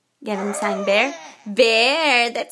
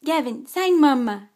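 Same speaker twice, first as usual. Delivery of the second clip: normal talking voice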